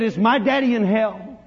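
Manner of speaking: high, loud, drawn-out plea; loud, slow, pleading question; anguished demand. Retold or imitated. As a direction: cry it out as a desperate child. Boy demands answer, second asking.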